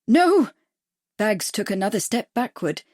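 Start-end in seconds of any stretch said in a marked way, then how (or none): 0.1-0.5 high, loud panicked no; frightened cry. A narrator's reading. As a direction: blurt out a terrified denial. Bags accused of murder.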